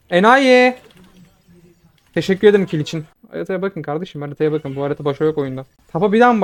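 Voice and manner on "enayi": taunting shout. Real, not acted. Enemy caught, taunting shout.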